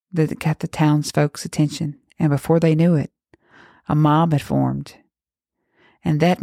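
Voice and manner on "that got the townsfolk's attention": ordinary delivery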